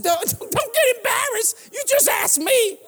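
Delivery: high, slow mock-soothing tease; teasing. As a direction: coax her with a high, drawn-out tease. Teasing the embarrassed nurse.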